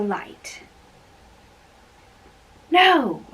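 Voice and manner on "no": a loud, high cry of no; sharp refusal. Acted. Romeo refusing to go masked to the feast, loud no. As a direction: shut the idea down hard.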